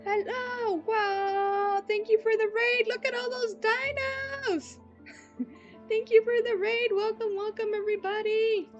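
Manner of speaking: high excited squeals; bubbly, high greeting voice; cute anime streamer. Real, not acted.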